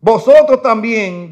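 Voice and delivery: loud, high, slow pulpit reading; fiery preaching. Acted. Preacher reading woes to his church, fiery and loud. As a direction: thunder the verses at the congregation.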